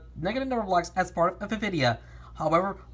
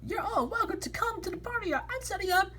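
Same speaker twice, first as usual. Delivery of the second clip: high character voice; 14 semitones higher, 4 dB quieter than usual. Acted. Character invites in story.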